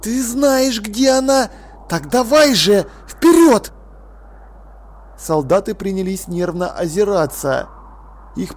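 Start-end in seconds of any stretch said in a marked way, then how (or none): 0.0-3.7 urging through clenched teeth, rising loud; through gritted teeth. A narrator's reading. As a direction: shove the words out past clenched teeth. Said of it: "сквозь стиснутые зубы".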